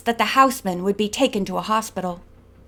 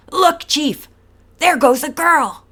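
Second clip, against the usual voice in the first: hoarse shout; 5 semitones higher, 4 dB louder than usual. A narrator's reading. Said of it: "she heard a hoarse shout".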